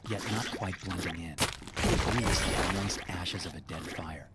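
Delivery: plain reading voice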